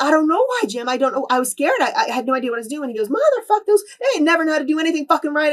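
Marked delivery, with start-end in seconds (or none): 0.0-2.9 higher, louder defensive protest; flustered pleading. Retold or imitated. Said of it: "I was like". 3.1-5.5 much higher, louder cursing; retold furious rant. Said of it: "And he went off".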